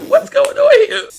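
high, drawn-out cry of disbelief; mock bewildered shout. Viewer reacting to Dex emerging from lava, baffled shout. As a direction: yell it slowly in exaggerated bafflement.

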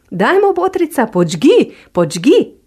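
eager bear. Acted. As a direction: push her on, impatient and high. Bear urging fox to light.

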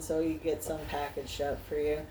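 normal talking voice

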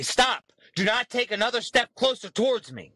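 high, firm command with bass in the chest; commanding stop. Shown as a demonstration. Demonstrating how to stop a pushy clerk, firm command. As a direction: bark the order like a wall. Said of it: "say it with that tone, with some fucking bass in your chest".

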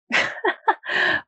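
high mocking laugh from the cage; a short wicked cackle; villain cackle.

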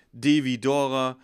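slow, loud letter-by-letter dictation; spelling aloud. Retold or imitated. Colleague spelling his name for police, slow and loud. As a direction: imitate someone carefully spelling their name. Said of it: "Buchstabieren Sie mal Ihren Namen".

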